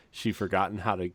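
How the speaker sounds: ordinary talk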